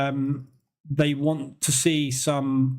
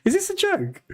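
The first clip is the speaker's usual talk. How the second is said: squeaky incredulous question, much higher; can't believe what he's reading; high-pitched shocked aside; disbelief. Real, not acted. Incredulous at Trump's post.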